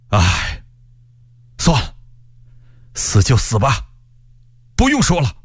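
a lament broken by sighs and pauses; sigh, then give up and accept it; resigned sighing. A narrator's reading. Stranger being led off to execution, sighing in resignation. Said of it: "一边走一边感叹一声".

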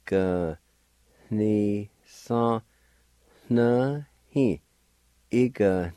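"E, g, ni, sa, na, hi" with nasal N and faint H before each; nasalized N sounds. Shown as a demonstration. Teacher drilling the nasal N in "E, g, ni, sa, na, hi". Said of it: "nasalized sounds. like this".

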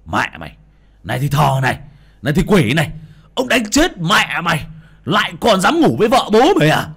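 high, loud furious cursing; father cursing while smashing the shrine; cursing rage. A narrator's reading. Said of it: "miệng chửi".

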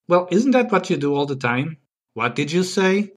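usual speaking voice

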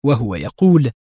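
ordinary delivery, nothing marked

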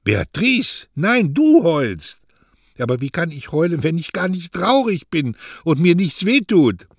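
high, loud protesting; flustered denial. Acted. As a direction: deny it with rising agitation. Mario denies crying, agitated.

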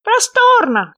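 high, loud, squeaky little frog; small creature's high, loud voice. A narrator's reading. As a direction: pipe up eagerly as a tiny frog. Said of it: "сказал лягушонок".

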